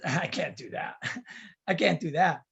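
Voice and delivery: low, laughing refusal; low chuckled brush-off, acted; dismissive refusal.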